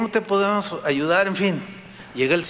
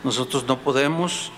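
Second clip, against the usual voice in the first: closing prayer; 4 semitones lower than usual. Acted. Pastor leading the congregation in prayer, humble and earnest. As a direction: pray humbly to God, dropping at each Señor.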